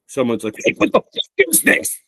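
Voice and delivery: ordinary delivery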